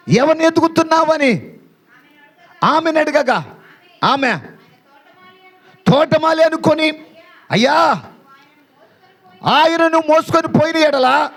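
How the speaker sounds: high-pitched, sustained declamation; impassioned preaching. Acted. (ఉద్వేగంతో ఎత్తైన స్వరంలో ప్రసంగం)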